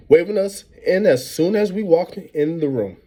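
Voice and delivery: usual speaking voice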